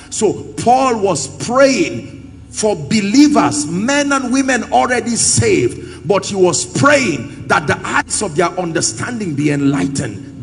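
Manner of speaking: loud, emphatic scripture proclamation; forceful preaching. Real, not acted. Preacher expounding Paul's prayer to the congregation, loud and emphatic. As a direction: preach it out with full projection, driving each phrase.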